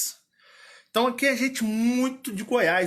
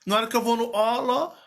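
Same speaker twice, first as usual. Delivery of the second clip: normal talking voice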